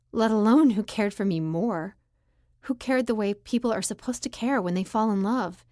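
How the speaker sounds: ordinary delivery, nothing marked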